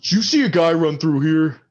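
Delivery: put-on high, loud drunken question; drunk friend. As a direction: ask it half-shouting, a little tipsy. Drunk friend asks the player.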